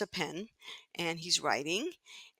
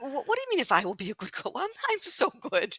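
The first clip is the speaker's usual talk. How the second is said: put-on indignant child protest; 5 semitones higher than usual. Child protesting the Rebbe's words.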